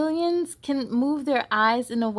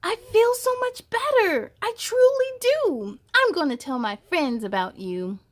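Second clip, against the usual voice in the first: high, bright creature voice of relief; happy monster; 9 semitones higher, 3 dB louder than usual. Acted. Cured monster thanking Doctor Jet, high happy creature voice. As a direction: lift way up like a grateful little monster.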